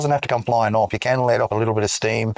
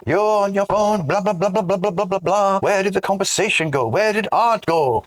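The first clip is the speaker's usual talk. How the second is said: Matrix dude rant; 10 semitones higher than usual. Retold or imitated. Mimicking the Matrix dude lecturing about phones. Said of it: "the Neovigian, you know, the Matrix dude".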